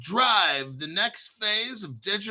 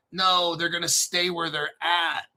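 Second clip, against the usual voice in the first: normal talking voice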